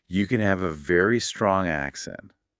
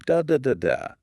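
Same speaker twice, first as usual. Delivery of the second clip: four even, higher-pitched "Da, da, da, da" beats; syllable-timed rhythm; 8 semitones higher than usual. Shown as a demonstration. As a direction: rap out "Da, da, da, da" evenly like a machine gun. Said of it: "Every syllable gets about the same amount of time".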